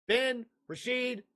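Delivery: normal talking voice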